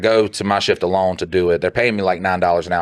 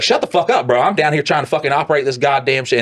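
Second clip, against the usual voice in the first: much higher, pushy telling-off; hammed-up worker brushing off the boss; mock angry retort; 6 semitones higher than usual. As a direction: snap back at a nagging supervisor. Operator telling off supervisor.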